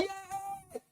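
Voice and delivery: very high, loud yell; hammed-up shriek of pain; pained scream. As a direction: scream as the needle stabs you.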